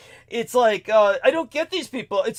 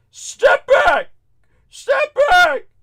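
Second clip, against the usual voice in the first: very high, loud squawk; mock hysterical shout; 6 dB louder, much slower than usual. Crying driver warding off passenger, mock hysterical shout. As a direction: imitate a hysterical man yelping orders.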